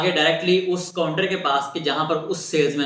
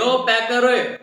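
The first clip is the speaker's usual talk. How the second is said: higher, called across the shop; calling out; 6 semitones higher, slower than usual. Retold or imitated. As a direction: shout the order to the packer. Salesman calls to packer.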